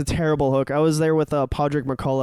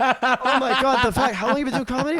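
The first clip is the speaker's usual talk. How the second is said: John O'Keefe; 9 semitones higher than usual. Retold or imitated. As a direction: imitate an aggressively nice drunk in awe. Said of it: "so aggressively nice when he's drunk".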